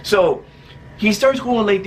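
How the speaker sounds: usual speaking voice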